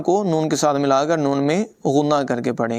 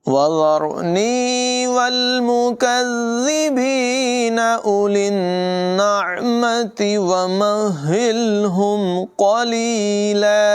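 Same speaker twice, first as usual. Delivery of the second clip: slow, higher melodic tajweed chant; 6 semitones higher, much slower than usual. Shown as a demonstration. Teacher recites the verse for students, slow melodic chant. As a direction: chant each word long and flowing.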